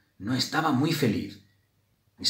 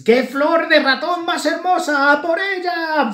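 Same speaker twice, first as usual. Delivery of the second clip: very high, loud swarm battle cry; excited bees; 7 dB louder than usual. A narrator's reading. Bees go after the beautiful mouse flower, excited shriek. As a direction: squeal it like bees giving chase.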